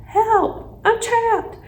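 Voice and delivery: very high little hamster squeak; squeaked cry for help. A narrator's reading. Hamster trapped under bag of treats, squeaking. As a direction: squeak it out in mock alarm. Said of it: "I squeaked".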